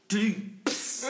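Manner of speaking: imitated ding, then hiss; short high ding and a spray of hiss; bottle breaking noise. Storyteller imitating a dropped bottle, ding and hiss. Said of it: "All you hear is".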